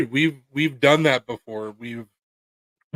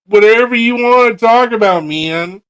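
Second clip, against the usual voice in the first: excited; 9 semitones higher, 12 dB louder than usual. Real, not acted. Excited pitch for podcast.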